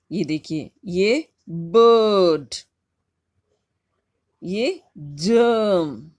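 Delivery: बर्ड and जर्म drawn out very slowly, r silent; teacher slowing down बर्ड and जर्म; slow model words. Shown as a demonstration. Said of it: "आर silent है".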